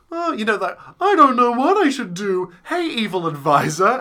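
high, dithering weak king voice; wishy-washy king. Retold or imitated. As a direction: play a spineless, unsure king. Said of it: "kind of a flimsy, flamsy, wishy-washy".

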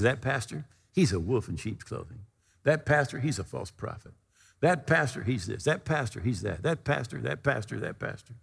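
imitated gossip that fades from soft down to a whisper; a man badmouthing the pastor, his voice sinking until it is gone; fading grumble. A man repeats his gossip about the pastor, fading to a whisper.

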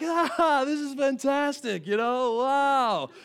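lightly hammed-up serene angler; slow, high and blissed-out; mock blissful fisherman. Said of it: "Everyone else is like".

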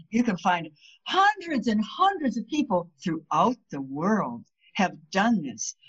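ordinary delivery

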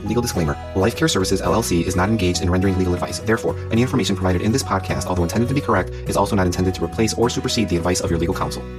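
rapid, low, clipped-in disclaimer read; fast legal disclaimer. Acted. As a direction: rattle off the fine print quickly. Podcast's closing legal disclaimer read.

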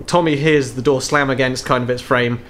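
ordinary talk

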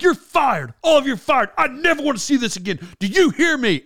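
high-pitched mock yell; imitated furious firing. Star firing the whole crew, mock yelling. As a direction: play the boss firing everyone on the spot. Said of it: "says he's gonna fire 'em".

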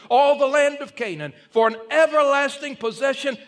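loud, high, fervent preaching; preaching at full force. Acted. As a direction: drive it home like a revival preacher. Scripture reading rises to full force.

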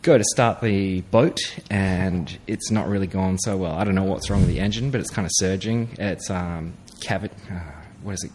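ordinary talk, nothing marked

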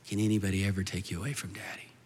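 low, hushed fatherly question; tender reassurance. Retold or imitated. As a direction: softly reassure her by asking. Father soothing frightened daughter at night.

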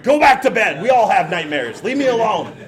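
put-on loud, impatient brush-off to a child; fed-up parent barking the kid back to bed; annoyed dad. Tired parent snaps at child.

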